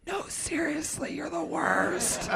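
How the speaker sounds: slow, breathy whispered jab. Retold or imitated. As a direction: drag it out quietly to needle him. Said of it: "and he goes".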